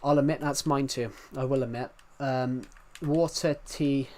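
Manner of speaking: normal talking voice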